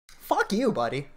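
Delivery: sudden high-pitched cry of contempt; angry outburst. Real, not acted. Ranting streamer lashes out at senator, high angry cry. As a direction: spit it out, pitch jumping up.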